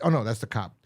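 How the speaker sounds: usual speaking voice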